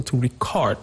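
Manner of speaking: record said as re-CORD; verb stress. Shown as a demonstration. Teacher illustrating the verb, second-syllable stress. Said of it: "stress is on the second syllable".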